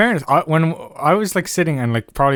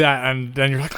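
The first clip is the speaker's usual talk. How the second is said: ordinary talk, nothing marked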